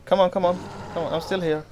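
fired-up challenge to attackers; goading shout. Real, not acted. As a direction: egg the enemy on, high and loud. Taunting zombies in fury mode.

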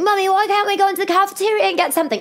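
put-on high, fast whining kid; whiny child. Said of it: "my daughter's like".

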